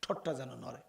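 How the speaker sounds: whispered, barely voiced; softly. Shown as a demonstration. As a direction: drop to a hushed murmur. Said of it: "আস্তে আস্তে".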